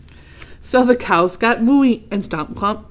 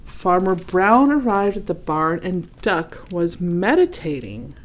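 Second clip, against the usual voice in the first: usual speaking voice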